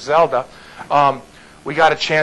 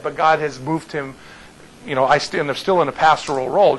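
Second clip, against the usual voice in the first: ordinary talk, nothing marked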